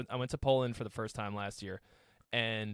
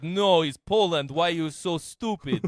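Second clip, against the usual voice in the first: mock Polish accent; 6 semitones higher, 9 dB louder than usual. A Pole scolding the confused American, in a loud mock Polish accent. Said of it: "with a super thick accent".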